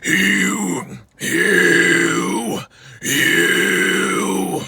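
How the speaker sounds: raspy, drawn-out growled scream; low scream attempts. Shown as a demonstration. Coach struggling to get the low register, low scream. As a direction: keep working the low rasp. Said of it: "I gotta get it".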